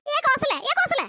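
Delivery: high call down the street. Acted. Neighbour calling Kosala passing by, high call. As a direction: hail her from afar.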